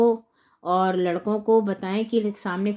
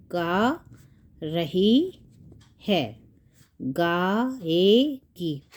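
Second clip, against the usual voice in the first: syllables drawn out with gaps between; word by word, है dropping low; slow dictation; 3 semitones lower, much slower than usual. Shown as a demonstration. Teacher writing out verb forms, slow dictation.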